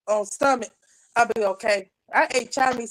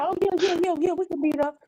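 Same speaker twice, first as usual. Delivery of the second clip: brush-off; 7 semitones higher than usual. Retold or imitated. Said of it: "He's like".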